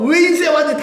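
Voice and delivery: high, loud glad greeting; father's welcome. Acted. Father greets returning son, high and glad. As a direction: open arms, a father calling his son in.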